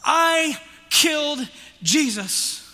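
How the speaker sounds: loud, high and slow, each word struck; loud confession. Shown as a demonstration. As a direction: proclaim it slowly to the whole room. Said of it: "Say that with me".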